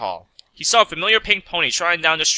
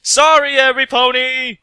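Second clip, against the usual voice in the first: high, loud called-out sorry; yelled apology; 10 semitones higher, 6 dB louder than usual. A narrator's reading. Mech apologizing to knocked-down ponies, loud shout. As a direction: shout back to the whole street. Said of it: "He turned around and yelled".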